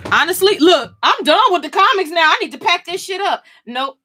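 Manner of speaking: high, loud and fast-tumbling; abrupt excited squeal; excited outburst. Real, not acted. Sudden high exclaiming.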